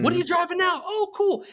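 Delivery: "What are you driving now?" asked high and bright, mildly excited; upbeat salesperson. Shown as a demonstration. Salesperson asking a caller about the trade, cheerful. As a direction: lift the whole question, keen and friendly. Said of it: "You just say".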